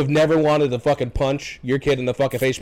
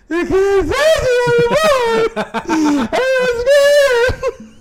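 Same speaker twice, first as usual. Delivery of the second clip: put-on very high, slow, sobbing child voice mixed with laughter; crying scared kid; 7 dB louder, much slower than usual. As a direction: imitate a wailing little kid while cracking up. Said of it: "he goes".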